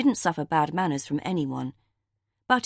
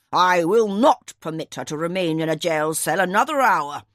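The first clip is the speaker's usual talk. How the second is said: put-on louder, stern commanding old man; raised voice; 2 semitones higher, 6 dB louder than usual. A narrator's reading. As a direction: demand it at once, voice raised. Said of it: "rarely raised his voice ... but he did so now".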